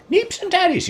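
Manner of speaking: high, loud mock-puzzled echo of "Neeps and tatties"; a mock-baffled guest echoing "Neeps and tatties" very high; puzzled listener. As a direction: squeak it back as a baffled guest. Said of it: "you say".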